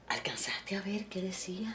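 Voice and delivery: whispered, secretive question; hushed question. Retold or imitated. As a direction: lean in and whisper it. Friend asks about the dream.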